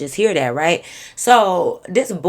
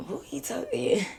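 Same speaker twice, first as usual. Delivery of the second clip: much softer, slow breathy whisper aside; 9 semitones higher, 11 dB quieter than usual. Real, not acted. Storyteller whispering to viewers about the caller, slow whisper. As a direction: hush it like a secret to the listeners.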